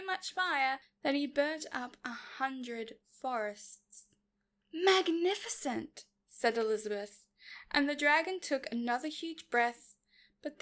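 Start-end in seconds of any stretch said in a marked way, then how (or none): 4.7-6.0 higher-pitched, impressed cry; admiring exclamation. A narrator's reading. Elizabeth praising the dragon's fire, admiring. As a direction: exclaim in admiration to egg someone on.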